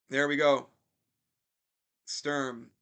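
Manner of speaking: lively hockey commentator calling the action; play-by-play announcer. Acted. Gamer commentating his hockey game to viewers in announcer voice.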